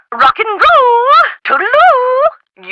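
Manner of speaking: high, drawn-out sing-song goodbye; flamboyant farewell. Acted. Eccentric professor ending a phone call, high lilting send-off. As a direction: trill the sign-off high and slow.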